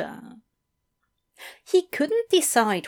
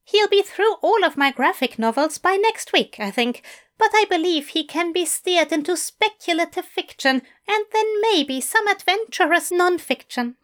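high, eager English teacher; bright, keen invitation from a fussy gentleman; 8 semitones higher, faster than usual. A narrator's reading. Fell voice.

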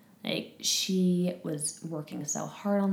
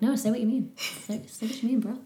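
higher-pitched playful protest to a husband; mock exasperated demand; 5 semitones higher than usual. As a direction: imitate yourself pleading with your husband, half-joking. Acted quote to husband in conflict.

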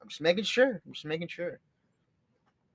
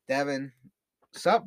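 usual speaking voice

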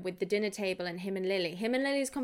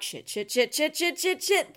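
panicked cursing; 6 semitones higher than usual. Retold or imitated. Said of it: "fumble and stumble and be like".

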